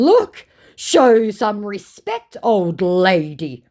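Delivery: slow, loud, hammed-up scammer; mocking scammer voice. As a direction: scold her, mock pompous. Reading the scammer's reply.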